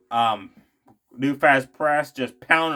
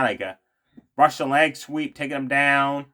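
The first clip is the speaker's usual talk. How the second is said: ordinary talk, nothing marked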